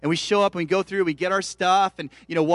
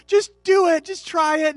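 exasperated urging; 12 semitones higher, 7 dB louder than usual. Retold or imitated. Dad urges son, exasperated.

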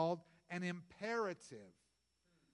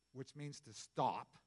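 usual speaking voice

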